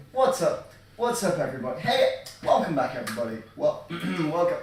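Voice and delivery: loud, high hype intro; YouTuber voice. Pretend. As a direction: welcome viewers like a hyped YouTuber. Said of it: "prepare my YouTuber voice".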